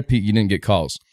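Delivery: ordinary delivery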